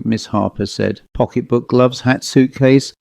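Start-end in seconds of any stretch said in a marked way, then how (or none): none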